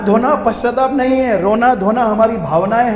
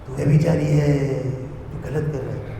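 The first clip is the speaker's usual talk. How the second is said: hushed rebuke; 6 semitones lower, 5 dB quieter than usual. Retold or imitated. Lord's servant accusing the speaker, imitated in a low, soft drone. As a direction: murmur it low, stretching the last word. Same retold rebuke repeated.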